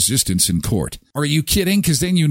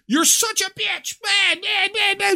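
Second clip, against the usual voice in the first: mock bratty kid rant. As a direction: mimic the raging eleven-year-old. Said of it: "And she's like".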